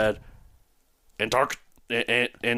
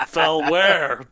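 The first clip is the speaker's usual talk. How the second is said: incredulous retort; 5 semitones higher, 6 dB louder than usual. Retold or imitated. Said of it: "And I'm like".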